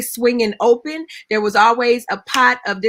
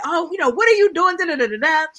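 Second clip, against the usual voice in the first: high, fast, frantic neighbor's scolding; excited stuttering neighbor; 7 semitones higher, faster than usual. Retold or imitated. Narrator imitates the frightened neighbor scolding her, high and fast. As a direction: rattle it off like a panicked neighbor. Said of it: "She's cussing".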